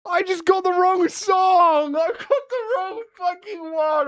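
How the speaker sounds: high, drawn-out wail of frustration; slow, high-pitched self-reproach; mad at oneself. Real, not acted. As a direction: whine it out in agony, close to crying. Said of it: "I'm so mad".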